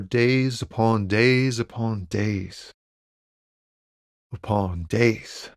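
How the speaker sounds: slow, stretched words sinking in pitch; drawn-out poetic musing. Acted. Trucker musing to a cop on being stuck, slow and drawn out. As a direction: linger wearily on every repeated "days".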